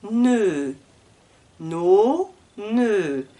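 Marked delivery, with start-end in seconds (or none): 0.1-0.7 "Ne" with tongue kept forward, loud and clear; front-tongue E. Shown as a demonstration. Teacher models the front E for learners. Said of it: "la langue reste devant".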